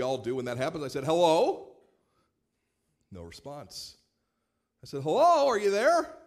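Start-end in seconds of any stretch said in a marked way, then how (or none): 1.0-1.7 loud, high "Hello?" into the phone; puzzled shout into a phone; calling out. Retold or imitated. 5.0-6.1 much higher, loud "Hello, are you there?"; loud, high call down the line. Retold or imitated. Calling into silent phone.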